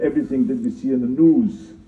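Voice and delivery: ordinary talk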